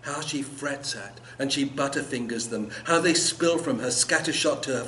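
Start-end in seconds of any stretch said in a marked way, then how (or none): none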